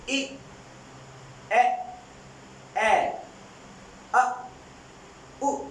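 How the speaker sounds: short vowels said one by one; vowel drill. Shown as a demonstration. Teacher repeats five vowel sounds for students. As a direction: model each vowel with a pause. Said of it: "मैं again repeat करता हूँ".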